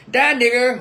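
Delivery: loud, high hateful shout; mock lynch-mob yell. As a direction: bellow it like the mob. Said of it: "they wasn't yelling".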